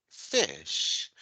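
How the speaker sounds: "Fish" with a short lax i; English vowel demo. Shown as a demonstration.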